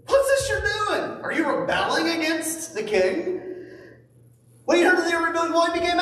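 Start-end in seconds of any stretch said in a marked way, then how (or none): 0.1-3.4 high, slow jeering; mocking taunt. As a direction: taunt them like a scornful enemy. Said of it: "they mocked and ridiculed them".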